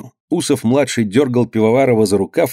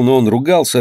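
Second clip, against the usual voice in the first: ordinary delivery, nothing marked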